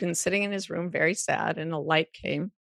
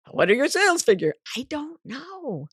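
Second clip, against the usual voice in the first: mock interview exchange; 8 semitones higher than usual. Acts out an interviewer and herself.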